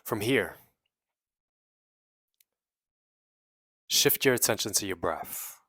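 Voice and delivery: measured meditation guide with long pauses; guided meditation. Real, not acted. Guiding listeners to release trapped emotions, in an unhurried meditation voice. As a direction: lead them inward phrase by phrase, leaving long silences.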